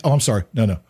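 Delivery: normal talking voice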